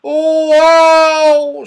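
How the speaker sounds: long, high, loud "oh"; shouted wail. A narrator's reading. Saucepan Man above the children, wailing loudly. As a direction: howl it out at full voice. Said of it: "shouted the saucepan man".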